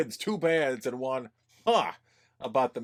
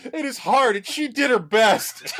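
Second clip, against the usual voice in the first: higher, louder, laughing through the earnest review; indignant read-out; 7 semitones higher, 4 dB louder than usual. Shown as a demonstration. Host reading a fan review aloud, mock-indignant. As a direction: defend Sandra like a wounded fan. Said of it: "this is the tone that it was written in".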